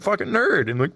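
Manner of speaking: normal talking voice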